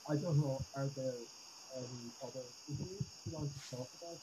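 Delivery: muted mic, words fading away. Real, not acted. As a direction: voice cutting out, faint and distant. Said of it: "You sound muted now".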